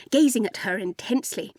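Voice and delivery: usual speaking voice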